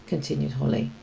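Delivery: usual speaking voice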